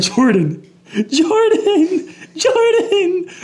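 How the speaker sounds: high-pitched squeal through laughter; imitating laughing brother. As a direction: mimic someone calling out while helpless with laughter. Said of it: "all I hear is him laughing".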